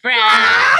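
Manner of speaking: loud full-voiced yell of the word; shouted response. Real, not acted. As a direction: shout it back at full volume. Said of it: "you say fried".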